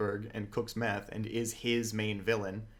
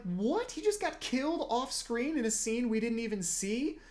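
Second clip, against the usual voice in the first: much higher, incredulous outburst; shocked disbelief; 12 semitones higher than usual. Retold or imitated. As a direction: sound floored by the twist. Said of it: "You're like".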